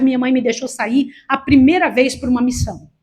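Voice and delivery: ordinary delivery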